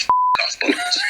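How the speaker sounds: put-on a small boy's cheeky retort; cheeky kid voice. The little lad telling Buttons off on stage, high child voice. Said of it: "he just goes".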